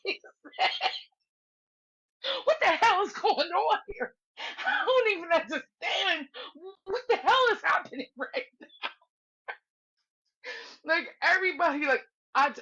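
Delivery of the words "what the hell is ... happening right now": high, wailing imitation of disbelief, laughing; baffled outburst. Slim reacting to the panel swooning, hammed-up bafflement. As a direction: squawk his confusion through laughter. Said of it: "Slim was, like, truly baffled".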